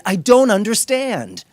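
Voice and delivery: loud, high exasperated yell; a husband snapping at his wife in frustration; retold yell. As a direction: shout it like he's lost his patience. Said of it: "then I yelled at her and said".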